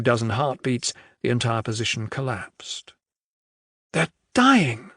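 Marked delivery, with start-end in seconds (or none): 3.9-4.9 urgent protest, a little raised. A narrator's reading. Adair protesting to the general as soldiers die, urgent. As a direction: push back in dismay. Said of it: "Adair protested".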